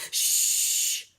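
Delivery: sharp unvoiced shush; hushing shh. Librarian hushing the loud girl, sharp shush. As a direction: hush her right now.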